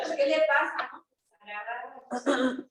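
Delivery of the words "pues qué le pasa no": mildly raised voice, louder; a hint of a nurse snapping at a patient; mock irritated retort; nurse's scolding. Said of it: "me espantaba porque decía".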